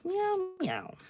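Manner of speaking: squeaky high meows at the cat. Pretend. Meowing high back at her cat, playful. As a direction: meow back at the kitten, high.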